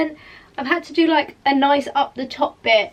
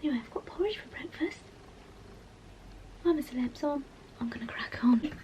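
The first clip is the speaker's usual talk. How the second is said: whispering; 10 dB quieter, faster than usual. Real, not acted. Whispering while partner is home.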